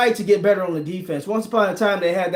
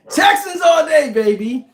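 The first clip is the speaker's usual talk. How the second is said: excited cheer; 9 semitones higher, 6 dB louder than usual. Real, not acted. Cheering for his pick.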